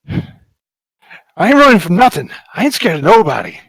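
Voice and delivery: put-on a scared kid puffing up to sound brave; kid acting tough. Scared kid bluffing to the helmeted stranger, high loud bravado.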